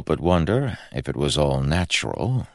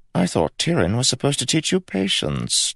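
chiding Rai, pitched up and teasing; 11 semitones higher than usual. A narrator's reading. Prince Rai chides Kel for his impatience, lightly chiding. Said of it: "chided Rai".

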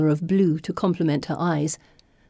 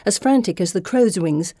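ordinary delivery

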